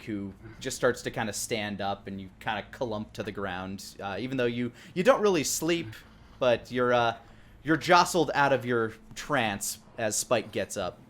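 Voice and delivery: ordinary delivery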